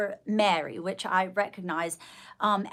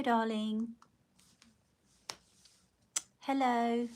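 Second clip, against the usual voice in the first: normal talking voice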